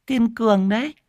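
high, strange voice echoing Kiên Cường đấy with a rise; parroting mimic. A narrator's reading. Madwoman echoes the old woman's words, high and odd. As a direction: echo the old woman's words back like a simpleton. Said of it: "nói nhại theo".

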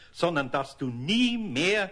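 slow, stressed nie; slow firm emphasis; stern insistence. Acted. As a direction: lean on nie. Eder insisting again.

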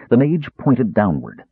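usual speaking voice

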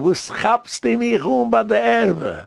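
high, slow mock yell; retold yell. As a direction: shriek the complaint high and stretched out. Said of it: "immer schreien".

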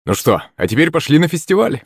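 eager exclamation, not shouted; exclaiming. A narrator's reading. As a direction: rouse the group to go. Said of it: "вскрикнул батя".

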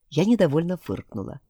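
plain reading voice

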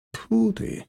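soft, high spat-out disgust; dismissive little spit; dry spit. A narrator's reading. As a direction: spit it out dryly. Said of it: "сплюнул Серый сухо".

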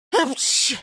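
high, sudden sneeze; acted sneeze. Robber in a dusty sack, high achoo sneeze. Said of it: "чихнув".

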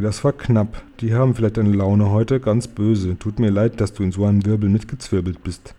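ordinary delivery

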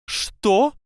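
sharp high startled cry; surprised. Acted. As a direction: blurt it out in shock. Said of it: "удивилась Минерва".

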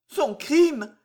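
high, distraught question; bewildered stammer. A narrator's reading. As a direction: echo it in shocked disbelief. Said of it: "balbutia l'infortuné".

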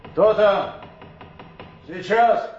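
loud, high call toward the door. Acted. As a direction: call out to someone outside.

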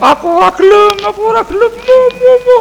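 put-on very high, childish whining of a refuser; squealing mock tantrum against the jab; mock bawling refusal. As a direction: play someone hollering that they won't take it. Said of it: "Ya aja bengak-bengok".

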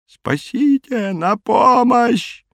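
very high, drawn-out wail; desperate cry for help. A narrator's reading. Terrified man calling for rescue, high desperate cry. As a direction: wail slowly in a high, put-on voice. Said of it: "кричал человек".

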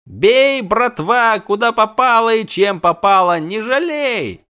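high, loud brute shout; rally the mob like a thug; rabble leader roar. Acted. Goat-eater whips the crowd on, rough loud roar.

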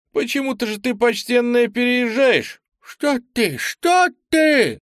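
put-on high, fussy old lady; old woman voice. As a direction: wave it off like a granny.

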